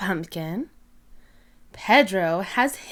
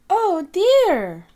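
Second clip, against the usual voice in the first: high, loud disappointed oh dear; mild dismay; 9 semitones higher, 5 dB louder than usual. Acted. Teacher noticing Peppa is missing, with mild dismay. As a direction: let it fall with gentle concern. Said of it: "says Madame Gazelle".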